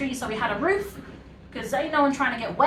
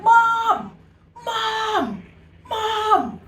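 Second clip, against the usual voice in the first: high loud calls of Mom up to the windows; children calling up; 11 semitones higher, 7 dB louder, much slower than usual. Retold or imitated. Kids on the estate calling to mums, loud high shouts. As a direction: yell up like kids wanting ice cream money.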